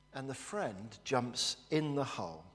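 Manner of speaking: ordinary talk, nothing marked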